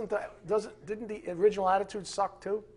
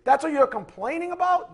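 loud, higher, stretched disbelief; incredulous outburst; 9 semitones higher, 8 dB louder than usual. Acted. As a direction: throw it at them in disbelief. Preacher mocking complainers.

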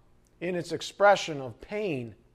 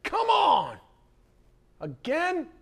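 much higher, exasperated burst; exasperated indignation; 13 semitones higher, slower than usual. Retold or imitated. Shepherd scolding a stuck sheep, exasperated and high. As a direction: throw up your hands at the sheep.

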